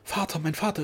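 high-pitched, frightened calling; anxious cry. A narrator's reading. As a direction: daughter calling out in alarm. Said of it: "angstvoll die Arme um ihn".

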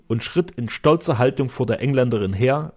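ordinary delivery

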